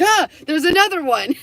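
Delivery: high, loud mock-startled yelp; startled cry. Man spots a second person filming him, mock-startled yell. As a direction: imitate a man jumping at the sight.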